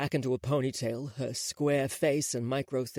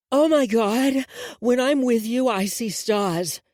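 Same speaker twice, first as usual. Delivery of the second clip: mock swoon; 9 semitones higher, 6 dB louder than usual. Ivy mocks with novel lines.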